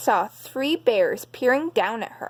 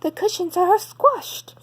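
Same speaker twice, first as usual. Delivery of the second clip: much higher mother bear voice; Mommy Bear; 9 semitones higher than usual. Acted. Mommy Bear notices cushions, higher mother voice. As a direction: keep Mommy Bear up high.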